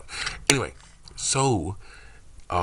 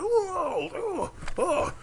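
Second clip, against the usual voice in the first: much higher, breathless mock astonishment; stunned gasp; much slower than usual. Knocked back by her emo power, he gasps in high mock shock. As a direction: act knocked back and amazed. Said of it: "he says that".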